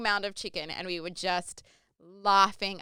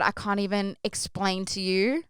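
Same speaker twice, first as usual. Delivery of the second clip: ordinary delivery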